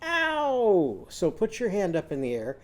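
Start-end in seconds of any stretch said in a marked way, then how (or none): 0.0-1.1 high yelp of pain, Ow!; a sudden high cry of Ow! after a bump; owl's pained ow. Acted. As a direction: owl bumping its head on the door, high ow. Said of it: "he hits his head on the door and he goes".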